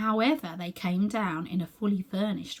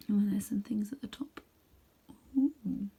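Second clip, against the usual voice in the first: whispered aside; 3 dB quieter than usual. Real, not acted.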